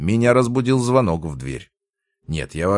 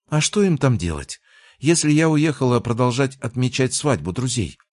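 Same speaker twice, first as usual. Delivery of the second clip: put-on surprised, higher-pitched girlfriend; mild surprise, pitched up, questioning; 8 semitones higher than usual. A narrator's reading. Girlfriend asks why they'd celebrate without her, surprised. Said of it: "удивилась девушка".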